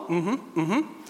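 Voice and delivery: high, rising polite hums; polite granny. Acted. Granny agrees the queue was too long, politely. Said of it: "suddenly switches into polite Granny".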